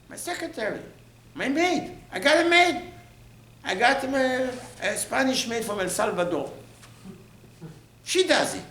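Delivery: very high, mock-indignant protest; haughty refusal. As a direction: play someone too important for chores, pitch way up. Acting someone too proud for chores.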